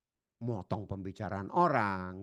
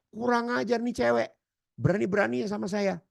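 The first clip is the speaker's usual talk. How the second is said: high, loud furious retort; angry man's outburst; 12 semitones higher, 6 dB louder than usual. Acted. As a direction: lash out like an insulted man. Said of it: "itu mikir lagi". (bentakan marah, nada tinggi dan keras)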